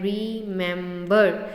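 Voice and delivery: Remember in three separate beats, "ree - mem - ber"; split into syllables. Shown as a demonstration. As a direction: break remember apart, syllable by syllable. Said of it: "three syllables हैं". (Remember को तीन हिस्सों में तोड़कर)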